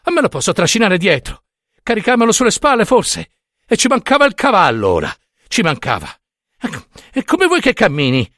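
high, sarcastic exasperated rant. A narrator's reading. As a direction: argue back, utterly fed up. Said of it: "proruppe egli esasperato".

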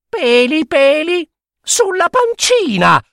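very high mocking child voice with giggles; high sing-song teasing rhyme; teasing little girl. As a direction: taunt the monster cheekily. Girl's taunt.

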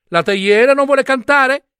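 high, loud mock-shocked cry; an old pen feigning outrage; pen exclaiming in high-pitched disbelief; indignant exclamation. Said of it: "esclamò".

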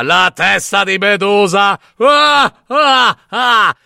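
loud, scornful shout into a cackle; mocking laughing shout. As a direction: jeer at the boy and laugh out loud. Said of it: "scoppiò in una risata".

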